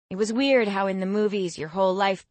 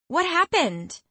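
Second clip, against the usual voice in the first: much higher, alarmed question; 11 semitones higher, 3 dB louder, slower than usual. A narrator's reading. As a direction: ask in sudden panic. Becky alarmed at friend.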